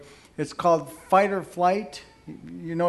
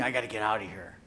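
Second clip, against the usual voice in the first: cornered husband's thought; 9 semitones lower, 7 dB quieter, faster than usual. Acted. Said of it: "He's just thinking".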